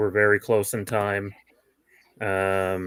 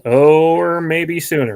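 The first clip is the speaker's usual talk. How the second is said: sudden loud exclamation, then slower; surprised; 6 semitones higher, 10 dB louder, slower than usual. Real, not acted. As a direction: caught off guard, blurting it out. Sudden pit change spotted live.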